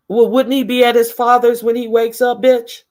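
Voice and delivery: high, exasperated challenge; mock incredulous. Host challenging the absent woman's story, incredulous. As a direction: push the question up high, incredulous.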